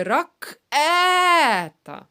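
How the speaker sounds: slow stretched "ракета"; drawn-out word. Shown as a demonstration. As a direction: sound out "ракета" slowly for children. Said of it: "teacher drawing out word".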